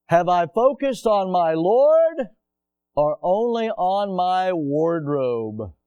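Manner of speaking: slow, drawn-out pointed question; slow emphatic question. Acted. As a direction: lean on each word slowly. Preacher poses pointed question.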